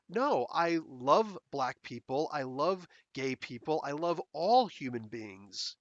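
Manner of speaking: slow, earnest mock sincerity; imitating a sincere statement; slow sincere declaration. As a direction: spell out the love slowly. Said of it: "he never said".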